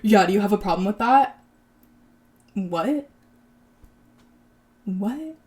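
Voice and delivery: mildly confrontational retort, questions rising; mock snapping. Retelling him challenging the guy at the checkout, mock snapping. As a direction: imitate someone picking a fight, without really shouting. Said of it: "he automatically snaps and he goes".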